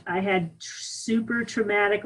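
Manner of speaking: ordinary talk, nothing marked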